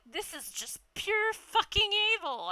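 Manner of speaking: high, indignant accusation; mock outrage. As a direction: call it pure evil, half laughing. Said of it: "He's like".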